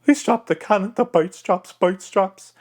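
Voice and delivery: high honking pirate voice; Ragetti. Shown as a demonstration. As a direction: squawk the words like a pelican, high and forward. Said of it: "find the character voice".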